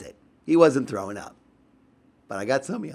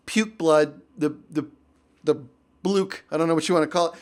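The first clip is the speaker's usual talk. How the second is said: usual speaking voice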